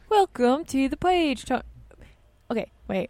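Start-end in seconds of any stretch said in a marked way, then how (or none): 0.1-1.6 high, slow mock-British welcome; badly faked British accent; attempted British accent. Shown as a demonstration. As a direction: draw out a put-on British greeting. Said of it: "This is me doing a British accent".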